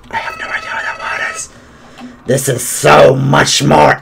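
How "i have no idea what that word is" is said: whispered aside. Real, not acted.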